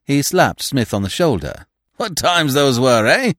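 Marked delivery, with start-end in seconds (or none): 2.0-3.3 loud, high hearty exclamation; jovial reminiscing. A narrator's reading. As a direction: reminisce with great gusto. Jovial stranger reminisces with gusto.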